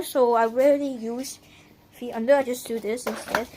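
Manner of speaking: ordinary delivery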